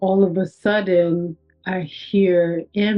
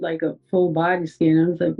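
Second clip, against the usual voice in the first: ordinary talk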